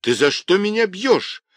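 high-pitched protesting yell; boy's wail. Acted. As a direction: cry out at being hit. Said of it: "завопил Юньге".

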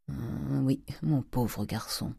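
soft, slow, hesitant condolence; hushed pity. Acted. Uncle breaking a death to his nephew, hushed. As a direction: break it gently, very quietly. Oncle annonce la mort du père.

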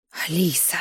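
breathless shocked whisper. A narrator's reading. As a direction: gasp the name out in shock. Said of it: "шокированно протянула Дионика".

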